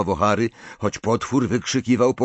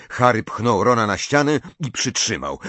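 usual speaking voice

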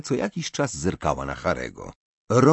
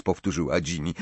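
ordinary delivery, nothing marked

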